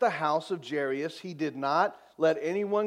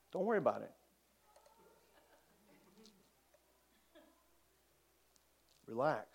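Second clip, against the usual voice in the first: calm reassurance; 11 dB quieter, much faster than usual. Acted. Jesus calming a father whose daughter died, quiet and unruffled. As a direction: soothe gently, with long pauses. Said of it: "This is Jesus' response".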